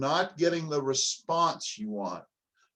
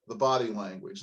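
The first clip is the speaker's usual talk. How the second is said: ordinary talk, nothing marked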